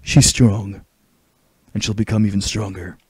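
raspy game-hero impression; Snake. Game hero speaking about a woman, raspy voice. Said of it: "my snake voice is getting more raspier".

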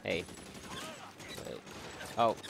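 ordinary talk

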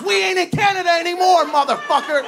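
high loud shout. Retold or imitated. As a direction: bellow it while lifting him.

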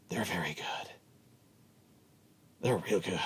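low, near-whispered aside; very soft and low, almost whispered; hushed aside. Real, not acted. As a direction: drop right down to a confiding hush. Hushed aside stressing their skill.

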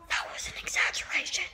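quick whispered aside from his other self. Acted. Vlogger's whispering alter ego cuts in, whispered. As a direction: hush it like a sneaky inner voice. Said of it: "Shut up, whispering me".

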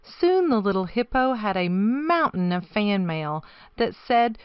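ordinary delivery

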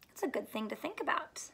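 usual speaking voice

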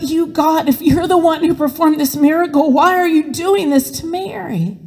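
high-pitched, urgent questioning of God; anguished reproach. A narrator's reading. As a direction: plead and accuse in one high-pitched rush.

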